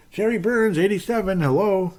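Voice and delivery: high mock fan yell at the host; mock angry fan yelling. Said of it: "people screaming at me about that one".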